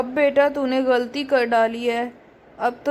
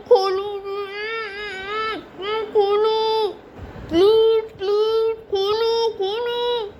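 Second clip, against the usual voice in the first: high, strained pleas through a gag; high muffled voice, words smothered and drawn-out; muffled, choking cries; 6 semitones higher, slower than usual. Acted. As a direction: beg to be untied with your mouth bound. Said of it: "क्यों चिल्ला रही है".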